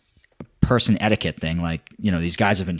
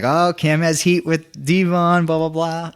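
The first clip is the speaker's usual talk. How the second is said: high singsong mock gossip; mocking blah-blah chorus; teasing crowd; 7 semitones higher than usual. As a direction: mimic everybody teasing, pitched up and drawn out. Imitating everybody's running joke.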